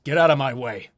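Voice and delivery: loud, commanding shout at the guards; angry shout. Acted. Disguised guard shouting at the birdmen to move. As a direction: bark the order to clear the path.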